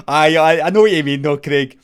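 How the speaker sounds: loud sarcastic jeer; loud mock reply. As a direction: shout it back with a sneer. Pals mocking Craig.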